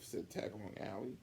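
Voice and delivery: ordinary delivery, nothing marked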